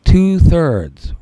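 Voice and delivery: slow, emphatic dictation; dictating. Shown as a demonstration. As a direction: spell it out slowly for notes. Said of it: "So write this next test term down".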